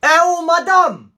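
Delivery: appel lent et fort; traîner le « madame » pour provoquer; drawn-out call. Acted. Calling teacher out. (appel moqueur à la prof)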